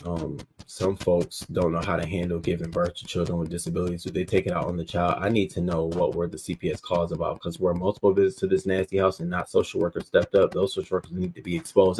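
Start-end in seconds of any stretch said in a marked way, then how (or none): none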